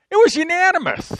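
very high, loud squeal; comic exclamation. Retold or imitated. Preacher reporting the vote against him, high comic exclamation. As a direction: squeal the result in comic protest.

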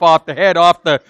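ordinary delivery

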